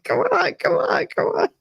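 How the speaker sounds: very high, laughing impatient mimicry; teasing mimicry. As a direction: mimic frantic tugging while cracking up. Mother mimicking her panicked tugging.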